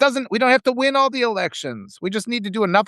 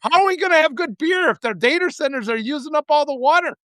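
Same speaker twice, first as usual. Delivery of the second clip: higher, indignant Wisconsin local; hammed-up Wisconsin accent, pitched up in protest; Wisconsin resident; 6 semitones higher than usual. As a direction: imitate an aggrieved Wisconsin beer lover. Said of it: "he's doing it in this character".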